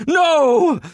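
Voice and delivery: high anguished demon cry; demon's cry. Acted. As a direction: cry out as the hammer falls. Sword smashed, brother cries out.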